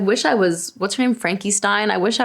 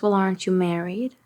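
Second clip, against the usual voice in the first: lightly put-on Long Island accent; a quieter hint of Long Island accent; Margot Robbie accent; 4 dB quieter than usual. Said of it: "can you do the Margot Robbie accent".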